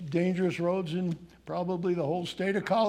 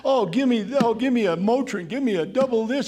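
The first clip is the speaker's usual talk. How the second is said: loud, higher, hammed-up pleading; mock begging; 5 semitones higher, 7 dB louder than usual. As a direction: demand relief in an urgent, repetitive list. Acts out begging for painkillers.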